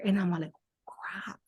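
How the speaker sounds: whispered aside. Retold or imitated. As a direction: drop to a whisper, caught out. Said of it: "whispered telling".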